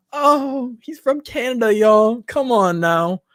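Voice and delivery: excited. Real, not acted. Reacting to a donation.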